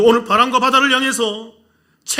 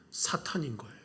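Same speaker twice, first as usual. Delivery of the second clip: whisper; 5 semitones lower, 18 dB quieter than usual. Real, not acted. Preacher's dramatic hushed reveal.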